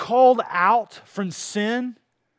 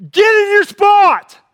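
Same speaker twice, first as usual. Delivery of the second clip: much higher, much louder bark of an order; coach yelling; 13 semitones higher, 13 dB louder, slower than usual. Retold or imitated. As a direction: yell it like a football coach at practice. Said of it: "You got a coach".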